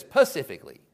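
imitating a Tennessee pastor's wife saying pacifically for specifically; a mild Tennessee twang on pacifically; Tennessee accent. Pastor's wife at a ladies' meeting, Tennessee accent. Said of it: "just hear her accent".

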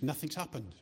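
usual speaking voice

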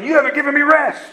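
high-pitched mock complaint at God; retold cursing. Dennis accusing God of withholding rest, imitated high-pitched complaint. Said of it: "then he would curse God again".

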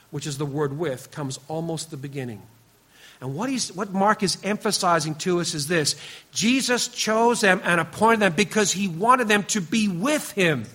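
ordinary delivery

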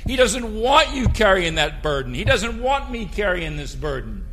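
loud, soaring pulpit voice; fiery preaching. Acted. Pastor exhorting the church about God's care: fiery preaching. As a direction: lift the congregation with full force.

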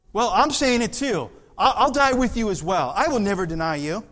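high, loud, eager protest; disciples chiming in. Acted. Preacher as disciples insisting to Jesus, high and loud. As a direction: jump in eagerly, all together.

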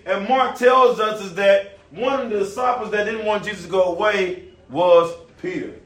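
usual speaking voice

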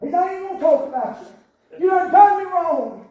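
loud preaching voice; full-force preaching. Acted. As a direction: drive it home to the church. Preacher rising again.

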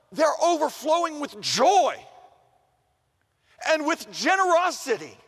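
high, loud and exultant; emphatic proclamation. Acted. Preacher declares what Paul writes about the poor givers, exultant and loud. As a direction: proclaim it, swelling on the key words.

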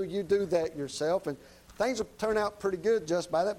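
usual speaking voice

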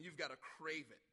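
ordinary talk

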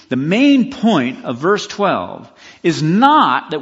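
slow and loud, stressing "not"; emphatic slow statement. Real, not acted. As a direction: drag it out and hit "not".

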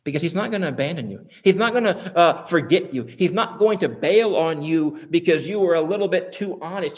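normal talking voice

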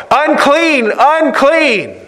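a leper's high anguished call; crying out unclean. Retold or imitated. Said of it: "he has to cry out".

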